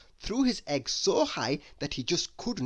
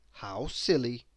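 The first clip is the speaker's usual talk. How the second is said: ordinary delivery, nothing marked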